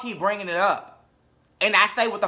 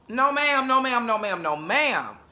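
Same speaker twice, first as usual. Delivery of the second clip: scared mock protest; 5 semitones higher, slower than usual. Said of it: "you were so shook".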